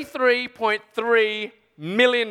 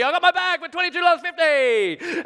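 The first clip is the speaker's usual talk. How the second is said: elated, pitched-up celebration; triumphant crowing; 9 semitones higher, 3 dB louder than usual. Acted. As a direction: crow about the bargain. Triumphant buyer after the bargain.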